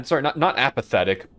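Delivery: normal talking voice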